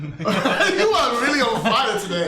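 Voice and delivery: chuckling through the words; laughing. Real, not acted. GM laughing at the player's big roll, amused. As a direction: laugh while praising the lucky roll.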